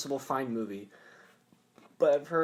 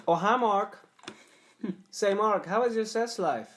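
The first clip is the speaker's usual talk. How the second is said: put-on odd accented actor, high and stilted; oddly cheerful stilted actor; Tommy Wiseau; 7 semitones higher, 3 dB louder than usual. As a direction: deliver it as awkward bad-movie small talk. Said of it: "ready to do my impression".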